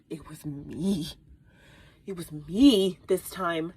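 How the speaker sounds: low, slow punchline; grim realization. Real, not acted. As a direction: drop low and drag it out. Deadpan punchline after mock laughter.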